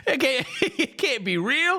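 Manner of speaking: high, slow, laughing it off; laughing disbelief. Real, not acted. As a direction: laugh through the words.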